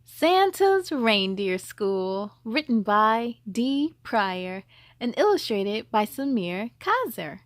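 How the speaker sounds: ordinary delivery, nothing marked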